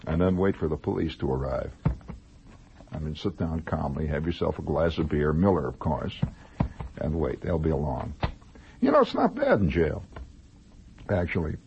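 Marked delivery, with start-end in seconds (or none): 2.9-8.1 mock calm. Calm advice to wait.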